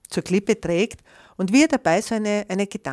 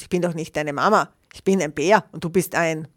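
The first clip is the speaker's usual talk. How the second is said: plain reading voice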